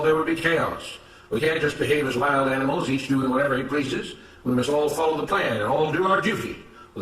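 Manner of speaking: firm, measured moral lecture; stern, righteous overseer. Acted. Mister Smith lecturing Samuel at the whipping post, stern and righteous. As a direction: scold the rule-breaker with self-righteous authority.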